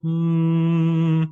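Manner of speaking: a full, buzzing closed-mouth hum; a steady high hum held on the lips; resonant hum. Shown as a demonstration. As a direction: hum it with no air leaking through. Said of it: "a real resonant, uh, non-airy hum".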